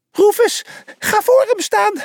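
very high, loud squeaky order; commanding mouse. A narrator's reading. The little mouse orders Rufus to block the shooter, high and squeaky. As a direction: bark the order in a tiny voice. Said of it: "beval Pliny".

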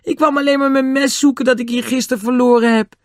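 put-on high, frightened young boy begging to be believed; frightened boy pleading. Caught boy pleads with the angry men, high and frightened. Nobby explains himself.

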